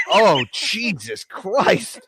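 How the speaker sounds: mock exasperated friend. Friend reacting to the chaos, slow exasperated. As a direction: drag it out in disbelief. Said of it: "he's like".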